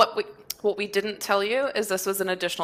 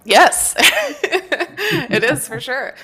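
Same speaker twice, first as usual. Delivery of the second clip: slow words through a laugh; 7 semitones higher, 8 dB louder, much slower than usual. Real, not acted. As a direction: laugh while you say it.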